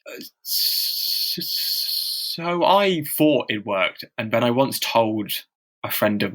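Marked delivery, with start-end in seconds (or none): 0.0-2.6 long real block on the "s" of "so"; stammering. Real, not acted. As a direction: stretch and repeat the "s" before "so" comes out.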